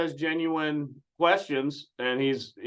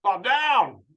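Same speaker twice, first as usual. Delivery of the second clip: higher, louder scolding host voice; mocking Johnny D; 6 semitones higher, 5 dB louder than usual. Host scolding the complaining husband, loud and high. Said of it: "Husband calls in to complain".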